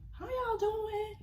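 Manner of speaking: high, slow, stretched-out hello; sing-song greeting. Retold or imitated. Checking in on friends, mock sing-song high greeting. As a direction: croon it like a cheery group-chat hello.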